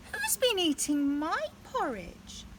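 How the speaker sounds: lightly voiced mother bear, drawn out; a hint of Mummy Bear.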